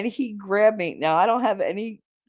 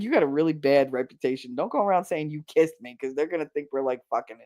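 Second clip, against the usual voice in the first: low, fast, hushed telling-off; low retold scolding; 5 semitones lower, 4 dB quieter, faster than usual. Warning him not to spread kiss rumours, low scolding. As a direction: warn him quickly under your voice.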